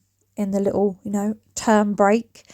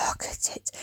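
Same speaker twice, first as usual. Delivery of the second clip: a much softer, hushed whisper; 14 dB quieter than usual. Pretend. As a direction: whisper "Look at it" like a secret. Repeating, fading to a whisper.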